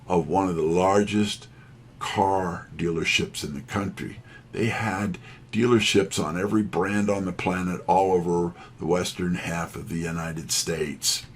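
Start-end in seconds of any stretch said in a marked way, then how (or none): none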